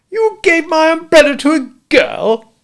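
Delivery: very high, loud incredulous cry; aghast disbelief. A narrator's reading. Walderwick aghast that Smith gave it away, incredulous.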